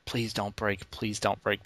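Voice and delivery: soft, hushed plea to a fragile console; quiet pleading. Retold or imitated. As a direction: beg it quietly not to break. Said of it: "I'm like".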